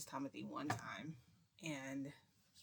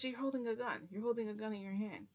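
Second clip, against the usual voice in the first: raised, louder insistence; retold accusation; 6 semitones higher, 4 dB louder than usual. Friend challenges the man holding the gun, insistent. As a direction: point out the obvious, exasperated.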